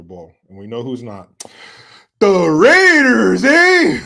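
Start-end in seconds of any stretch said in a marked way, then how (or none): 2.2-4.0 loud, drawn-out Chicano fan yell; Chicano homeboy accent. Acted. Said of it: "I can do that accent so well".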